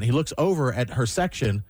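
ordinary delivery

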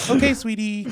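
high, sugary server voice; a sweet-talking waitress. Pretend. Playing the waitress.

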